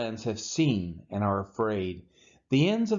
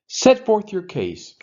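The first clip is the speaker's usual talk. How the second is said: proclaiming challenge; 7 semitones higher, 8 dB louder than usual. Acted. The Lord's challenge in scripture.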